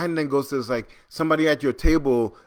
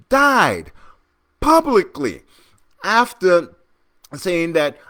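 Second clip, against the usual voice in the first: high, emphatic indignation; incredulous outrage; 5 semitones higher, 4 dB louder than usual. Real, not acted. Host addressing the party about a member's death, real indignant emphasis. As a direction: drive each point home, louder and higher.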